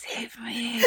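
breathy whisper for help; mock whispered plea. Cohost jokes she needs rescuing, mock whisper. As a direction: whisper it like a hostage.

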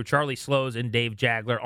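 usual speaking voice

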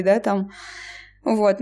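normal talking voice

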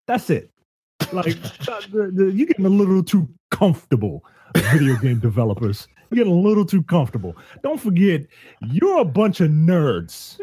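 wag a finger at the nerds, half laughing; mock lecture. Host mock-lectures game developers.

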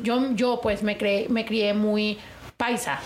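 ordinary delivery

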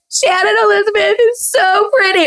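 very high, exaggerated crying-voice imitation; mock tearful outburst. Contestant blurting an excuse while crying, mock tearful. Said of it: "I'm sitting there crying".

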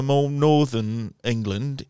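put-on slow English-accented explanation; Mike. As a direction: imitate a British friend correcting you. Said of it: "Mike used to say".

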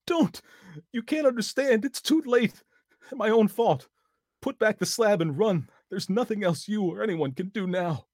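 very high, loud despairing scream. A narrator's reading. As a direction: scream in hopeless terror. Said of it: "a scream of utter despair".

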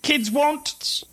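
high, stammering character voice; flustered professor. Acted. Professor asks the kids what they want, high and flustered. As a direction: stumble over it in a high voice. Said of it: "so he's like".

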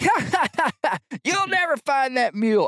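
high jeering laugh then the line; hammed-up mocking bar crowd; mock laughing barflies. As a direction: cackle at the poor guy, amused.

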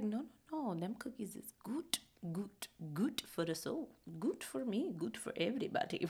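soft, hushed talking herself into treats; whispered self-talk. Retold or imitated. Herself justifying the cookies, in a soft hushed voice. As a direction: coax yourself quietly into the cookies.

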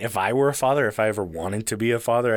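ordinary talk

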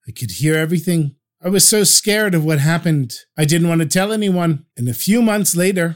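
ordinary delivery

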